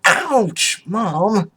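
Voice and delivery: very high, whiny yelp; pained hiss. Acted. Vinyl whacked by mother, high pained protest. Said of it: "as Vinyl hissed".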